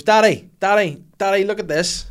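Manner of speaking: put-on high, loud child calling out for dad; imitating excited daughter. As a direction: mimic a kid demanding dad's attention. Young daughter calling for dad's attention.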